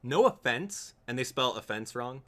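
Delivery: ordinary talk, nothing marked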